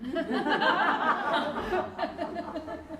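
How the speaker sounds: high, real laughter; a real laugh at his own joke; laughing at the punchline. Real, not acted. After telling the horse joke, the speaker laughs at his own punchline.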